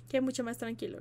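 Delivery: ordinary delivery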